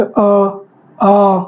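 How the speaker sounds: अ then a low, long आ, with the airflow audible; breathy throat vowels. Shown as a demonstration. Teacher asking students to notice the breath on अ and आ. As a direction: let the breath carry अ into a long आ. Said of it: "उस पर ध्यान लगाना है".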